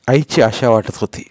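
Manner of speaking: ordinary delivery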